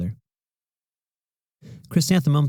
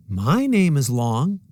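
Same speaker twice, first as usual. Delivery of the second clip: higher, slow, dreamy; music teacher; 5 semitones higher, 4 dB louder, slower than usual. A narrator's reading. Music teacher answering the children, lightly voiced. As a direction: answer the kids gently.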